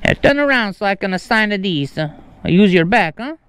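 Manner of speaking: mock Italian with added vowels; Italian accent. Prankster asking delivery person for a back to sign on, mock Italian accent. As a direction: stay straight-faced in a fake Italian accent. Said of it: "uh, an Italian accent".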